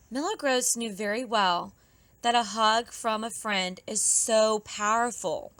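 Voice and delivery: ordinary delivery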